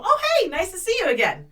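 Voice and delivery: high, bright mock-polite colleague greeting; imagined friendly greeting. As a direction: play the guy being nice and normal. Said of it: "he's gonna go".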